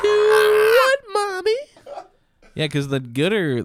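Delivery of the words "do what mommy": put-on very high, loud squeaky child voice; shrieked falsetto kid voice; squeaky little girl. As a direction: overdo the sweet innocent girl. Exaggerated child voice.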